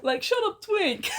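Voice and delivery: high giggly mock insult; real high laughing jab. Real, not acted. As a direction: tease while laughing. Laughing banter.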